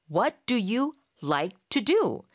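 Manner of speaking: slow, with a different voice colour; slow model repeat. Shown as a demonstration. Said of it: "이런 식의 문장을 만드는 순서".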